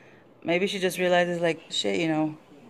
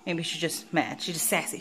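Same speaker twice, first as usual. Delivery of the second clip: ordinary delivery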